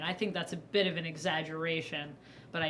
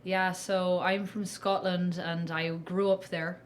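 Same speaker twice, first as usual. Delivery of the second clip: improvised Scottish accent, slow start; slower than usual. Shown as a demonstration. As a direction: demonstrate a tentative Scottish accent. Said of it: "my Scottish accent".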